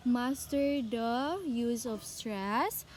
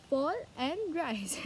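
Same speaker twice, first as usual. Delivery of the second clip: ordinary talk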